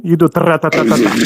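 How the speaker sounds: rapid tra-ta-ta gun burst; machine gun noise. Shown as a demonstration. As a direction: rattle it off like gunfire. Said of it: "you want to sound like a gun".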